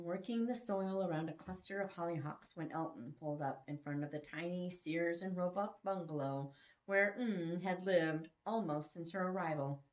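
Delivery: plain reading voice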